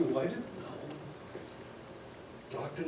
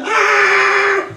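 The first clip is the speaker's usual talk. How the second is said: very high, very loud shriek of alarm; mock scream; 13 dB louder than usual. Guard finds underwear in the bag, shrill mock scream. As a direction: shriek like a startled security guard.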